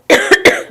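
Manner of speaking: loud double cough; demonstrated cough. Shown as a demonstration. Teacher illustrates what we do a lot, demonstrated cough. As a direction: give a hard, quick fake cough.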